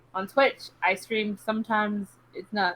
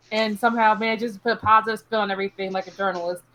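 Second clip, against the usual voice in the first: ordinary talk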